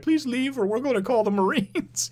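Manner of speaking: very high, softened mock-frightened voice; cowering plea. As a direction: plead like a comic coward backing down. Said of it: "falls to the ground cowering".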